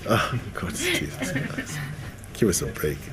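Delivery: mock despair at the clingy couple; exasperated. Onlooker watching a clingy couple, laughing exasperation.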